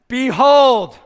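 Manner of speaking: a high, loud shout of behold; proclaiming shout. Acted. Preacher voicing Jesus to the crowd, shouted. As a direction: call it out like a herald.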